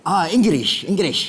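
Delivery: lightly voiced Korean-accented English; put-on Korean accent. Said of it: "with this accent?".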